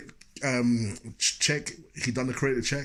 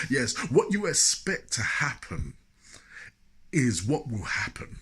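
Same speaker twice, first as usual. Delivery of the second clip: imitating a preacher. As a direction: lightly preach it like a man of God. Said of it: "I'm preaching good now, ain't I?".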